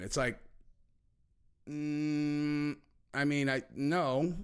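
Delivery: normal talking voice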